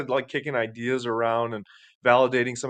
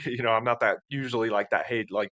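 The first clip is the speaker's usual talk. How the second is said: ordinary talk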